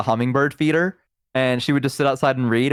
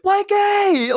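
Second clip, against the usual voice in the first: mock child's yell. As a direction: call out for a lost thing like a small kid. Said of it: "Like, yelling for it".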